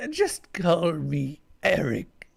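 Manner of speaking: slow, growled order; a mild alien growl, drawn out. Acted. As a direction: grind the words out slowly. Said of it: "Another growl".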